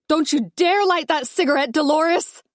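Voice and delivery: high, forceful yell; wife yelling at mother-in-law not to smoke. Acted. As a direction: yell a warning. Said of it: "I yell".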